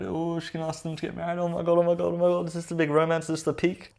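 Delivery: high-pitched gushing fake thrill; hammed-up romance-fan excitement; mock excitement. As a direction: gush as if a proposal is coming. Mock excitement at a proposal guess.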